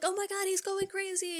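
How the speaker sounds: high, soft mock panic; muted panic. Men shut outside the door, high soft panicked voice. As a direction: squeal it small, as if from far off.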